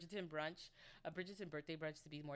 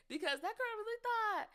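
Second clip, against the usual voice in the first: very high, loud squeal; scoffing glee; 10 dB louder than usual. Real, not acted. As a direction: squeal it in gleeful disbelief. Mocking a naive girl after cackling.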